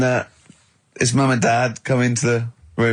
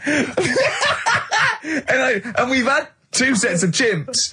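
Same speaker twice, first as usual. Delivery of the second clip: words still bubbling with laughter, higher; laughing; 9 semitones higher, much slower than usual. Real, not acted. Co-host laughing while comparing to the chimps. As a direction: talk through the giggles.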